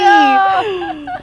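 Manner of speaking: loud high cry of dismay; shouting. Real, not acted. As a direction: yell it in frustration. Reacting to game failure.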